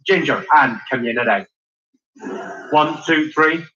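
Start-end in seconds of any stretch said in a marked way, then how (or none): 0.1-1.5 lower-pitched Belfast demo; Belfast accent. Shown as a demonstration. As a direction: lead the room in broad Belfast. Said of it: "Let's go Belfast".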